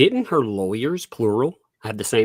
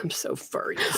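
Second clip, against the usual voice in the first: mock whisper; 9 dB quieter than usual.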